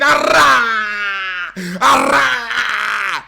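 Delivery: long, loud, high wails; mock agonised cries. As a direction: wail as each blow lands. Imitates cries under the blows.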